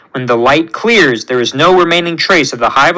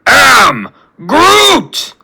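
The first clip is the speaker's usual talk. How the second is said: slow, booming word-by-word roar; drawn-out battle cry rising high; Groot's scream; 3 semitones higher, 4 dB louder, much slower than usual. A narrator's reading. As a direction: roar each word separately, climbing to the last. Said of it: "Groot nods and screams".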